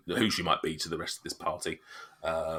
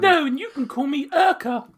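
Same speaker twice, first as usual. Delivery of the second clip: high haughty NPC; 8 dB louder than usual. Acted. NPC correcting name.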